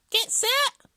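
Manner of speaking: very high, loud hare voice; short high-pitched starter's call; hare starting a race. A narrator's reading. Hare readies for the race, calling high and loud.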